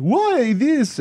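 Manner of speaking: high-pitched mimicked accent; Kumail accent impression. Comedian replaying his friend's voice to prove a difference, accent impression. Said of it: "You said, you said".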